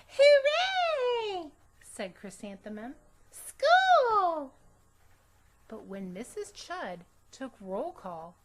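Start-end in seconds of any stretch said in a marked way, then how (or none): 0.1-1.5 high, loud, thrilled cheer; excited cheer. Acted. Girl cheering on her way to school, high loud cheer. 3.3-4.5 high, loud, thrilled cheer; excited cheer. Acted. Girl cheering on her way to school, high loud cheer.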